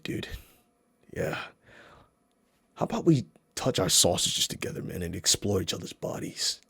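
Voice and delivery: low murmur, creepy and intimate; creepy hushed voice. Acted. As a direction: hush it like a creep leaning close. Creepy soft whispery aside to sausage.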